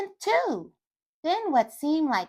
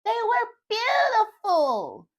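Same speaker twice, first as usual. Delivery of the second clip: excited; 9 semitones higher, slower than usual. A narrator's reading. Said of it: "with enough excitement to fill up the whole house".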